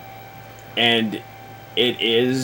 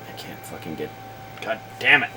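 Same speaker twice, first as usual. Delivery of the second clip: frustrated swearing under the breath, much softer; muttered curse; 3 semitones lower, 10 dB quieter, faster than usual. Real, not acted. Host swearing at a stuck setting, muttered much softer. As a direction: curse quietly at the screen.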